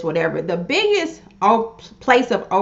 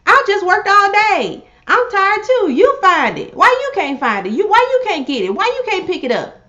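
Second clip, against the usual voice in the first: high, exasperated inner rant; frazzled wife venting what she really thinks; inner exasperation; 9 semitones higher than usual. Retold or imitated. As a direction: let the tired frustration burst out, pitched way up. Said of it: "But inside, you're like".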